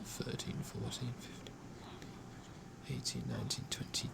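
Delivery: very soft, half-voiced counting; counting under breath. Real, not acted. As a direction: count quietly to yourself, barely voiced. Counting rivets under his breath.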